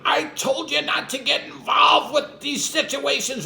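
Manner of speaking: high, shouted scolding; imitated angry yell. Wife yelling at her husband in the theater, high angry shout. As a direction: yell at your husband for meddling again. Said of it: "starts yelling at me".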